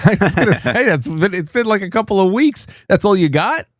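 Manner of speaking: high, laughing disbelief; laughing at a friend's tiny beard regrowth; laughing, teasing. Real, not acted. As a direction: tease him about the short beard through laughter.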